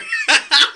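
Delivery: very high, loud laugh that bursts out; high laughter. Real, not acted. Laughing at own story.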